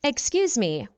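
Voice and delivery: ordinary talk, nothing marked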